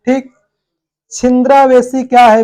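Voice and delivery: normal talking voice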